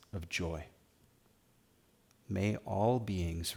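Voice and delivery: guided meditation. Acted. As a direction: guide them gently into stillness, phrase by phrase. Leads guided meditation and prayer.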